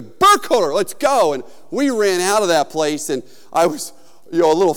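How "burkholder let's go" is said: raised, higher urgent command; urgent call. Retold or imitated. Big friend grabbing him to flee, imitated urgent shout. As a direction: call out to get him moving.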